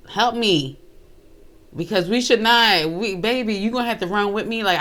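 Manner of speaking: ordinary talk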